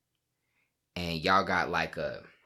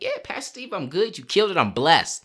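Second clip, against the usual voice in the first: bright churchy reply; 7 semitones higher than usual. Retold or imitated. Voicing his wife answering the pastor, high and chirpy. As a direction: sing it out, over-cheerful church voice. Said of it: "You're like".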